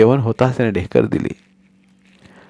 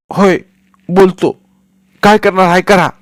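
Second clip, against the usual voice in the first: defiant shout; 10 semitones higher, 7 dB louder than usual. Acted. Son defies father.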